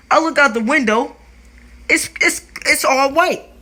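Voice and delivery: can't believe the snow; shocked. Real, not acted. Shocked at the blizzard.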